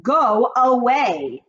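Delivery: put-on a snooty wife shooing the old woman off; drawn-out haughty shooing; haughty dismissal. A narrator's reading. Mrs Jenkins dismissive.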